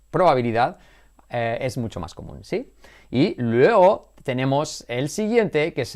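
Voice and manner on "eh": ordinary talk, nothing marked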